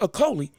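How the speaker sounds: "Okolie" with an L, no G; "Okolie" said with l where the g was; corrected name. Real, not acted. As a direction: fix the name to "Okolie", an L before the final ee. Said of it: "There's no G in the name".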